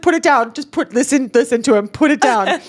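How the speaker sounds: high-pitched insistent plea; mock-urgent, pushing each command; retold urgent warning. Warning the client while giggling, high urgent insistence.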